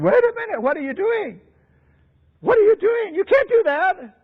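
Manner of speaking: high-pitched, alarmed objection; acted-out exasperated interruption; agitated protest. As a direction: cut in with a high, urgent protest.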